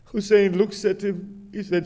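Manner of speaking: ordinary delivery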